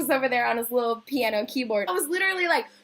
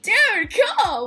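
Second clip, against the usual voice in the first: high, loud, delighted reaction; re-enacted enthusiasm; 7 semitones higher, 7 dB louder than usual. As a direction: burst out with friendly excitement. Acts her delighted reply to him.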